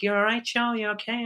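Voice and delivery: high, gentle check-in from the director; concerned director. Retold or imitated. Director checking on a crying young actor, high and gentle. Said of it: "He was like".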